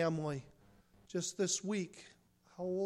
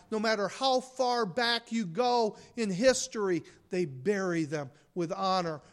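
ordinary talk, nothing marked

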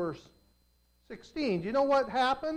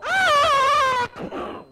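high, loud scream of falling; 5 dB louder than usual. Acted. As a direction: cry out as the ground gives way. Swallowed by the earth.

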